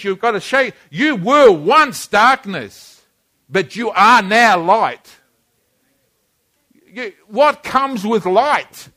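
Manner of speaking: loud, rising preacher's fervor; preaching at full force. Acted. Preacher rousing the standing congregation, fervent and loud. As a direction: drive it home like a revival preacher.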